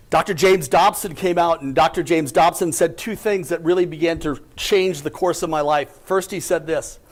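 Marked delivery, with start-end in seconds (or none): none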